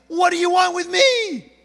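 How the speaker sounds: high, loud frightened cry; startled shout. Retold or imitated. Priest Zechariah startled by the angel, shouting in fright. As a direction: jump back and shout it in fright. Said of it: "he's startled".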